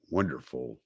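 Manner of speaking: usual speaking voice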